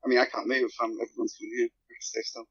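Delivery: ordinary delivery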